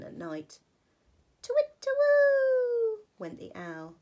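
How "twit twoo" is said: high, loud owl call twit-twoo; owl hooting. Acted. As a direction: hoot like an owl.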